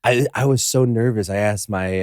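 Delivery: ordinary talk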